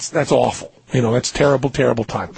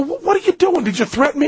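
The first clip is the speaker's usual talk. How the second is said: high, stammered questioning; 9 semitones higher than usual. Retold or imitated. Questioning the stranger who threatened him, high-voiced. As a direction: pitch the retort way up. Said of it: "Stop with the high voice".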